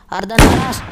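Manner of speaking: loud rising yell of the name; excited shout. Real, not acted. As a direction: call it like a goal. Player about to score. (teriakan bersemangat)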